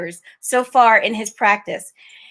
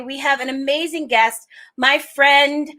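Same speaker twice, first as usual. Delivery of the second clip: ordinary delivery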